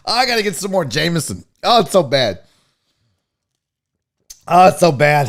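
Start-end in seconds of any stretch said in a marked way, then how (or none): none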